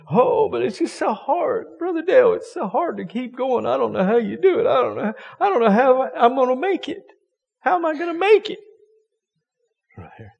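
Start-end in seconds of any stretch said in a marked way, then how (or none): 0.1-8.6 mock a member who moans it's too hard; whiny believer. Pastor mimics a churchgoer whining to Brother Dale, high and pleading.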